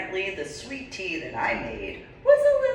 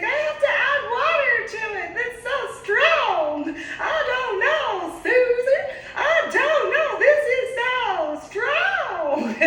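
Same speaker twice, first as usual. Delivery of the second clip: put-on Mary's Georgia accent; 7 dB louder than usual. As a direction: do Mary's Georgia accent, aghast at strong tea. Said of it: "if I'm on point with that accent".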